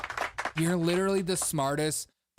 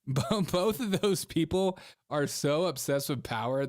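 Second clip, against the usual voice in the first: ordinary delivery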